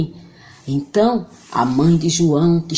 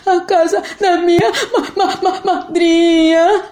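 menino aguda gaguejando de nervoso; stuttering scared boy; 11 semitones higher than usual. Acted. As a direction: gagueja com riso nervoso. João gagueja com medo. (menino gaguejando)

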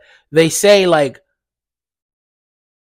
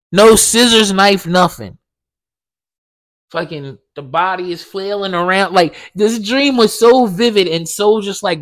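usual speaking voice

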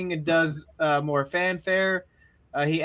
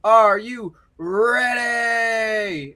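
long drawn-out shout, higher and louder; crowd-hyping shout; 5 semitones higher, 5 dB louder, much slower than usual. Acted. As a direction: stretch it out to pump up the arena. Champion hypes the crowd.

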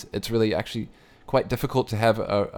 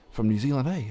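put-on New Zealand accent; 3 semitones higher, 4 dB quieter than usual. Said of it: "and I heard his accent".

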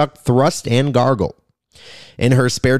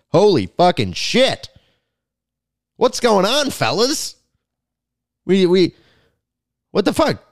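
shocked outburst; 8 semitones higher than usual. Real, not acted. Shocked reaction to divorces.

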